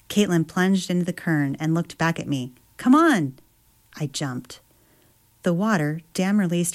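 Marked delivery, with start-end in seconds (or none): 2.8-3.3 high, eager call to follow; urging call. Acted. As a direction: egg her on to jump. Caitlin urges from the water.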